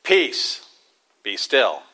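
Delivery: lightly voiced commanding rebuke; a hint of Jesus rebuking. A narrator's reading. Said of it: "rebuked the wind and said".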